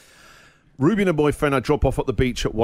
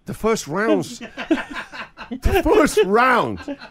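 higher, very slow, laughing disbelief; incredulous plea; 8 semitones higher, much slower than usual. Retold or imitated. Dad tells daughter it's only round one, higher laughing disbelief.

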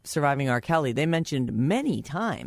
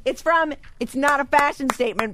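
excited; 6 dB louder than usual. Real, not acted. Announces winning answer.

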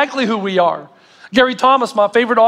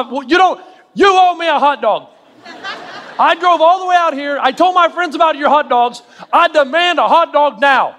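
mock indignant demand; 6 semitones higher than usual. Preacher acting out his hot dog tantrum at the golf course, mock furious. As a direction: play up a spoiled customer's fury. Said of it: "that's what I was feeling".

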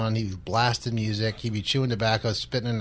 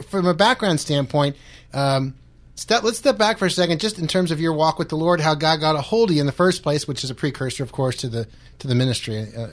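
usual speaking voice

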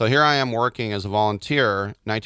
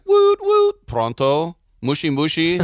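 high squeaky woot then pronto, mushi mushi; phone callers in foreign languages; 5 semitones higher, 4 dB louder, slower than usual. Retold or imitated. Mimicking foreign callers on the fax line, high and loud. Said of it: "you would call and be like".

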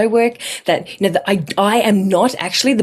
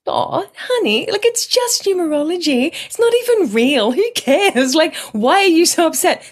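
soothing mum voice; 10 semitones higher than usual. Retold or imitated. Imagined mum soothing her upset daughter, high and cooing. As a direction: coo it like a mum calming her upset child. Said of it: "I was looking for my mum to go".

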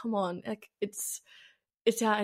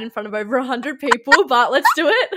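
louder, higher, breaking into real laughter; laughing; 5 semitones higher, 9 dB louder than usual. Real, not acted. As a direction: laugh your way through the punchline. Breaks up laughing at own anecdote.

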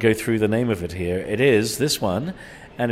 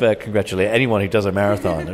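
ordinary delivery

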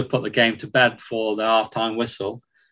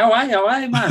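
imitating a Geordie press guy, pitched high and louder; Northeast accent; 12 semitones higher, 3 dB louder, faster than usual. Press guy greets them at the box, mock Northeast accent. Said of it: "I can't do the accent".

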